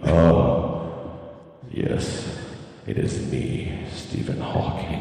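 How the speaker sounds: slow unvoiced whisper, low and mechanical; Stephen Hawking voice. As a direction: breathe it out like a speech computer. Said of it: "That's not even close".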